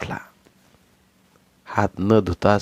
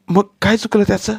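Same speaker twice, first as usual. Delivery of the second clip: angry retort; 12 semitones higher, 5 dB louder than usual. Acted. Wife argues back.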